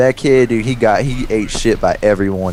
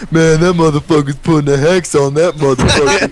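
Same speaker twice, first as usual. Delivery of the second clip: lightly voiced amused friend, a bit higher; a touch of a put-on voice, slightly raised; [name]'s remark; 6 semitones higher, 3 dB louder than usual. [name] joking about the hex, lightly voiced and raised.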